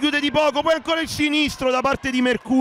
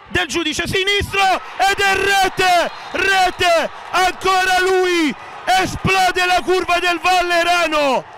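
excited goal shout; 7 semitones higher, slower than usual. Real, not acted. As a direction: roar the goal call. Goal scored, crowd erupts.

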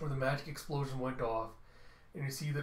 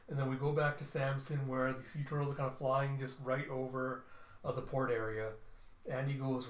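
ordinary delivery

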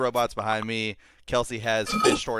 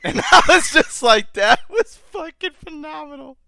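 high, laugh-filled praise; 6 dB louder than usual. Real, not acted. The speaker raving about the robot's line, laughing high. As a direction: laugh it out with delight.

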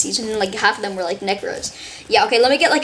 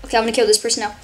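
ordinary talk

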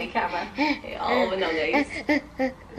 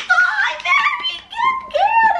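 very high, slow, laughing shout of praise; excited praise; 4 dB louder, much slower than usual. Real, not acted. Praising toddler's correct answer.

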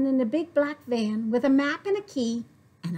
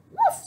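woof; 4 dB louder than usual. A narrator's reading. Dog's bark in refrain.

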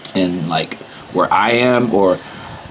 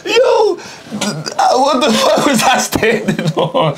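laughing; 13 semitones higher than usual. Real, not acted. Laughing at his dirty shoe.

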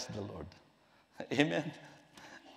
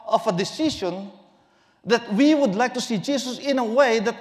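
raised, ringing sermon voice; full-force preaching; 6 semitones higher, 8 dB louder than usual. Acted. Preacher urging friends, loud fervent preaching. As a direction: preach it out loud and high to the congregation.

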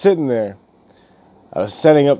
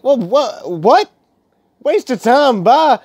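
indignant disbelief; 10 semitones higher, slower than usual. Retold or imitated. Him echoing the rude caller in disbelief. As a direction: repeat her words in mock outrage.

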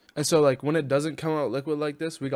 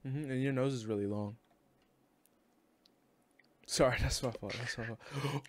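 normal talking voice

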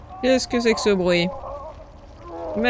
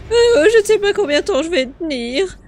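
high, whiny mimicry of her plea; sarcastic imitation of a helpless woman; mocking whiny echo; 9 semitones higher, 4 dB louder than usual. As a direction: parrot her plea in a much higher, mock-pitiful voice. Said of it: "Pauvre chérie".

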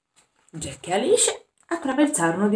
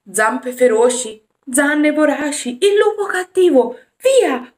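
loud, much higher shouting; 6 semitones higher, 7 dB louder than usual. Acted. Alice shouts at the wolf to drive it away, loud high yell. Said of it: "urlò Alice".

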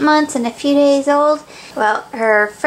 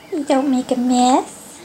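ordinary talk

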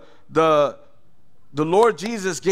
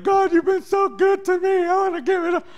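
much higher, overwhelmed cry to God; overwhelmed prayer; 14 semitones higher, 4 dB louder than usual. Retold or imitated. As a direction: cry out grateful and floored. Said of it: "floored 'cause I'm like".

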